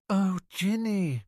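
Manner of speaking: a mother close to tears; tearful exclamation. A narrator's reading. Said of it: "Molly exclaimed tearfully".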